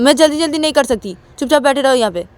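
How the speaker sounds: acted mild telling-off; annoyed refusal. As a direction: refuse to be rushed, voice lifted. Refuses to hurry.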